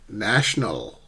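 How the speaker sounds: "National" stressed on NA, short vowel; careful front-stressed "National"; word-form model. Shown as a demonstration. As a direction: say "National" alone, first syllable strong. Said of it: "How do we sh-, shift stress?".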